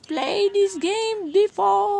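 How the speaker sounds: high, slow booming doll announcement; loud, drawn-out creepy doll. Pretend. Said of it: "Pwede na ba maging manika, guys?".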